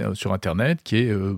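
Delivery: normal talking voice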